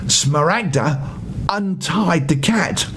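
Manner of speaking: plain reading voice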